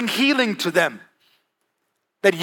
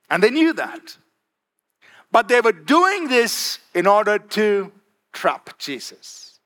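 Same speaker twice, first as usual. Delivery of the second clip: usual speaking voice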